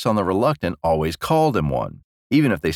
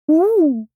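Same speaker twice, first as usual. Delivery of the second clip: high-pitched, louder "ooh"; wordless coo; 5 dB louder than usual. Acted. Queen answering her king without words, high coo. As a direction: coo it very high in place of speech. Queen can only make sounds.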